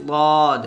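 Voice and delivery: দদ slow, with a heavy drawn vowel; thick DAD. Shown as a demonstration. Teacher names the next letter, heavy drawn দদ. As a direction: thicken and lengthen দদ. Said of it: "দদটা মোটা করে উচ্চারণ করতে হবে".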